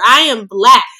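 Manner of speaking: ordinary talk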